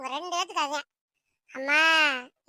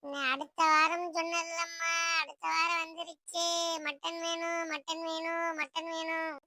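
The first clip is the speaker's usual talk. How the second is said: put-on nagging child; 2 semitones higher, slower than usual. Child reminds mother of last week's promise, nagging. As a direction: drone the demand with held endings.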